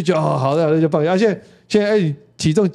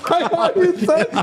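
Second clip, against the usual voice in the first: laughing echo; slower than usual. Real, not acted. Echoing the punchline while laughing.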